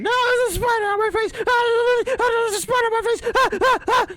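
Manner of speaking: high, loud panicked shriek; screaming at a spider. Pretend. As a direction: screech in mock terror. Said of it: "If I start screaming".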